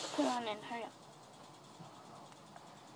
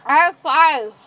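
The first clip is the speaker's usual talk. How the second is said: loud, through a stuffed mouth; cheeks stuffed full; 11 dB louder, slower than usual. Real, not acted. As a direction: shout around a mouthful. Five marshmallows in mouth.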